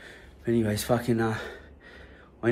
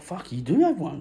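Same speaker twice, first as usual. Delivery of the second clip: higher, amazed mate's voice; surprised mate; 7 semitones higher than usual. Retold or imitated. Bully's mate amazed the narrator has a gun. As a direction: play the mate gobsmacked at the gun.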